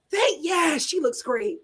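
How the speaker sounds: ordinary talk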